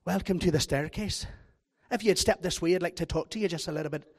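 mock Scot-Irish tour-guide greeting; Scot-Irish accent for greeting visitors. Shown as a demonstration. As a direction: welcome them in a put-on Scot-Irish brogue. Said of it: "sort of a Scot-Irish accent".

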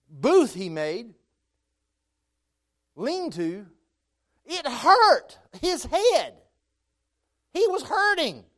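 high, loud, fervent preaching; preaching at full force. Acted. Preacher to congregation, describing Jonah's pain, fervent full-force preaching. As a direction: drive the suffering home to the congregation.

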